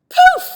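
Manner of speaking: high, loud magic poof. Acted. Shapeshifter casting the spell, a loud high poof.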